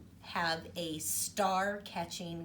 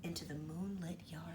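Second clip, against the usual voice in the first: soft, breathy storytime hush; hushed reading; 4 semitones lower, 8 dB quieter than usual. A narrator's reading. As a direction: read it like a bedtime secret.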